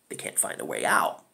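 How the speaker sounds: soft, hushed, breathless aside; ominous whisper. Acted. Reviewer teases the horror to viewers, in a hushed whisper. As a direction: whisper it like a scary secret.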